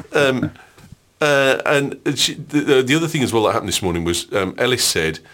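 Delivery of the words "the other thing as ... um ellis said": normal talking voice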